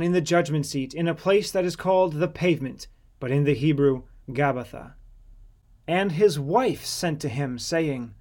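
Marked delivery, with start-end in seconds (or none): none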